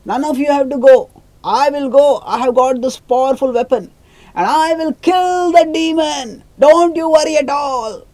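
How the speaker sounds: put-on high, loud, brave child's boast; heroic little boy. Little boy reassures his family, high loud heroic vow. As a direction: puff up like a tiny hero vowing to kill the demon. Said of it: "speaking so heroically".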